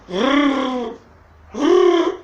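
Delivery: high, hard-rolled R demonstration; mock teacher. Supervisor mimicking the teacher's R lesson, playful high trill. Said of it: "¿Por qué me imitabas?".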